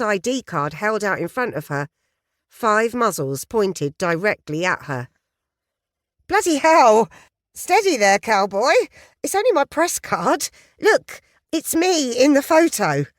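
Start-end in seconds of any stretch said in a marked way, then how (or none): 6.3-13.1 much higher, loud alarmed cry; high, loud, flustered British outburst; startled eager journalist. Acted. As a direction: feign flustered surprise at the guns. Guns pointed at her, staged alarm.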